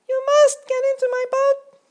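very high, loud squeaky little mosquito; a tiny self-important ferryman insisting on rules. A narrator's reading. Mosquito ferryman lecturing Grasshopper, very high and loud.

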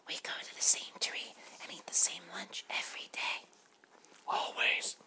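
hushed, breathy little butterfly voice; whispering butterfly. Acted.

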